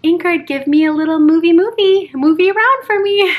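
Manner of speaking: put-on high, loud, sing-out child voice; imitating young daughter. Daughter talking to the baby in mom's belly, high imitated child voice. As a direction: call to the baby like an eager little kid. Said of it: "Fiona will ask her".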